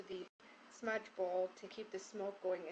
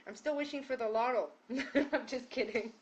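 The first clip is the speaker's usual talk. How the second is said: laughing through a louder, higher joke; 4 semitones higher, 8 dB louder than usual. Real, not acted. Healer joking to her class, laughing out loud. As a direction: giggle the line out, teasing yourself. Joking about wishing for lotto.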